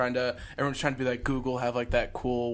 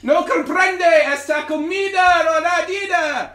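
mock Spanish; 8 dB louder, slower than usual. Fake Spanish aside, loud high voice.

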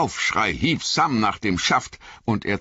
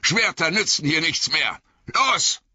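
much higher, sharp snapped order; wizard snapping; 11 semitones higher than usual. A narrator's reading. The wizard snaps at Aragorn, sharp and commanding. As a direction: bite it off at him. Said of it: "schnauzte der Zauberer ihn an".

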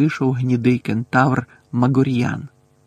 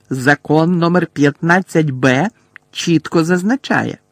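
shrill officious lecture; 3 semitones higher, 6 dB louder than usual. A narrator's reading. Umbridge lecturing centaurs.

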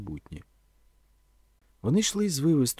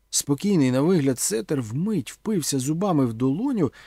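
plain reading voice

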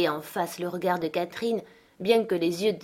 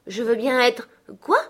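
higher, louder puzzled question; surprised; 6 semitones higher, 5 dB louder than usual. A narrator's reading. Girl questions the word she just heard, surprised. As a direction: break off in surprised disbelief. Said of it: "demanda Catherine surprise".

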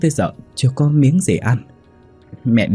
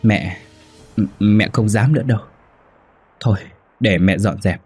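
hesitant, stammering frightened reply; mildly acted fearful mother; trembling. A narrator's reading. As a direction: stumble meekly over the words. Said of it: "Bà Tâm run rẩy đáp".

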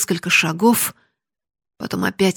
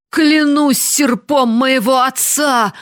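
loud, high, slow oath; shouting; 6 semitones higher, 8 dB louder, slower than usual. Acted. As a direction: bellow the oath in horror. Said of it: "закричал побледневший старик".

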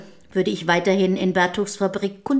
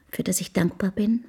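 soft grateful whisper; 5 dB quieter than usual. Acted. Housekeeper confessing her gratitude for her fate, whispered. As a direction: let the gratitude drop to a whisper.

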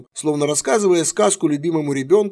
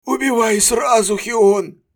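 choked sob; 8 semitones higher, 4 dB louder, slower than usual. A narrator's reading. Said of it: "он сдавленно всхлипнул".

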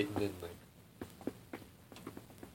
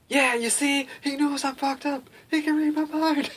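very high-pitched mock freak-out; 5 dB louder than usual. Visitor loses it in front of the swami, mock freak-out. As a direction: wail it high and loud, close to tears. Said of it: "freaking out".

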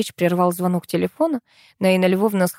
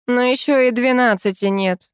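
mildly indignant protest, higher; 6 semitones higher, 4 dB louder than usual. A narrator's reading. Administrator objecting that it's not yet twelve, mildly indignant. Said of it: "возмутилась та".

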